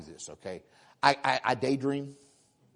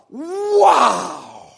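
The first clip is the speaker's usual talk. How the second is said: high, loud amazed wow; awed wow; 9 semitones higher, 8 dB louder than usual. Retold or imitated. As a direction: marvel out loud at the verse. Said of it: "And I thought".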